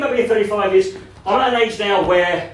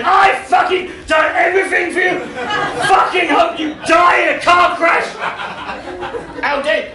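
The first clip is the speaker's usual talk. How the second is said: hammed-up boozy fury at a friend; drunken angry rant; 7 semitones higher than usual. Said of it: "pissed, going".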